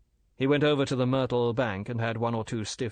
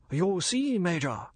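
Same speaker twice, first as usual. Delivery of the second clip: higher, smooth merchant's voice; polite and bland, a dealer explaining; bland Chinese merchant; 7 semitones higher, slower than usual. Acted. Older Fu brother lecturing the major, smooth and polite.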